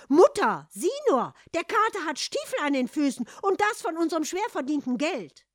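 high, loud tattling outrage; indignant. A narrator's reading. Sister reports the purchase to mother, indignant. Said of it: "seine Schwester und sagte entrüstet".

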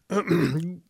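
mole's short cough; acted throat clearing. Mole announcing himself to Eduard, small cough. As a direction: clear the throat before speaking. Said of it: "Der Maulwurf hustete wieder".

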